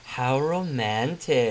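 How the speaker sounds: slow, teasing swoon with a grin; grinning. Acted. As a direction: draw it out, teasing the would-be couple. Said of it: "with a grin".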